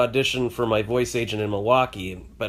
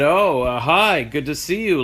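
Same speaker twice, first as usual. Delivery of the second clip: sulky greeting; 4 semitones higher, 5 dB louder, slower than usual. Retold or imitated. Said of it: "I was being a baby".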